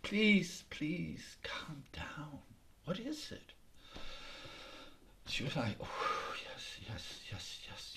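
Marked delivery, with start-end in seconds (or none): 0.0-3.5 hushed, calming the panicked deer; soothing whisper. Acted. 5.8-7.9 whispered, breathless yeses; hushed panicked yes. Acted. Shocked deer answers breathlessly.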